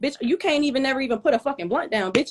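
ordinary delivery

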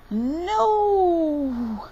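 high, drawn-out storybook wail; crying out. A narrator's reading. Little fox refusing hair washing, high cry. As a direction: cry out in high-pitched protest. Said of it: "cried Little Fox".

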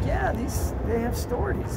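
put-on high, excited little girl; little kid voice. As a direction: mimic a chirpy kid. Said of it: "she's like".

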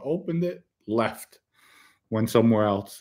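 ordinary talk

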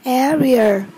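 "Area" with the first syllable stressed. Shown as a demonstration. Teacher modelling vocabulary for learners, "Area" stressed on first syllable. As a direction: put the weight on the opening A of "Area". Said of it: "nhấn âm một".

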